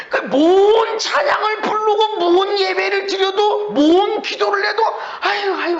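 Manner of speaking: a hint of a fed-up churchgoer sighing; sighing worshipper.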